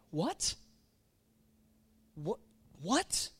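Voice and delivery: sharply rising, baffled what; repeated questioning what, swooping upward; incredulous what. Acted. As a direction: ask it in disbelief, pausing between tries. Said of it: "I'm imagining God's voice inflection".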